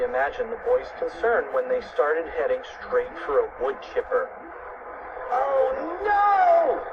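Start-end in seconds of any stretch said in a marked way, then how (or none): 5.3-6.8 high, drawn-out cry of dread; alarmed cry. A narrator's reading. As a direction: cry it high, stretching the vowel. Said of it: "cried Fluffy".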